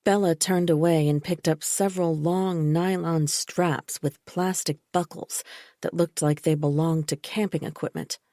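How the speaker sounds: ordinary delivery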